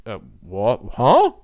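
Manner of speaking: baffled, ending in a loud questioning yelp; confused. Acted. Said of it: "face spasmed in confusion".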